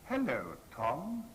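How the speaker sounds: TOM lifted in a slow teasing rise; rising, blood-curdling inflection. Retold or imitated. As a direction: draw out "Hello", then let "Tom" curl upward. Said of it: "in that kind of rising, blood-curdling inflection".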